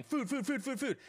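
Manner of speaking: high, eager yapping food chant; excited dog voice. Retold or imitated. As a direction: play the hungry dog begging for dinner. Dogs excited at feed time.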